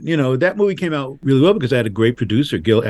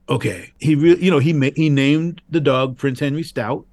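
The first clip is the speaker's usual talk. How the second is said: ordinary delivery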